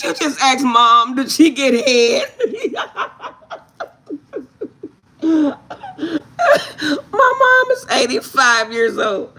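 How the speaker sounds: high, loud, slow, laugh-broken words; real laughter near tears; laughing hard. Real, not acted. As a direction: laughs almost to crying. Laughing retelling the question.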